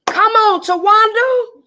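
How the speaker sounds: high, loud and slow-drawn invitation; eager come-on to a friend; excited call. Real, not acted. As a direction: beckon her over with delight. Calling a viewer over.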